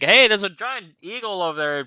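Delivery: yelling it out. Acted. Player alerts the party to a giant eagle, yelling it out. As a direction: call out the sighting across the party. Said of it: "I'll just yell it out".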